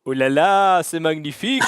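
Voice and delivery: loud mock French chef exclamation. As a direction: belt it out like a proud French chef. Mock French flourish on entering.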